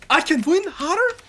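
ordinary talk

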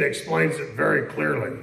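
ordinary talk, nothing marked

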